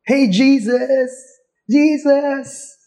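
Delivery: calling out high and stretched; mock yell across a distance to Jesus; slow, high call for help; imitated shouting. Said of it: "Siguro sumigaw sila".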